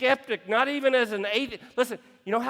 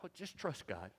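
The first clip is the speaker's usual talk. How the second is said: pious platitude; 2 semitones lower, 9 dB quieter than usual. Retold or imitated. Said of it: "What do we tell 'em?".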